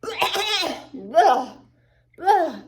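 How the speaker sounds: high, loud disgusted spitting; loud grossed-out bleh. Acted. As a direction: spit it out in disgust. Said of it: "she cried".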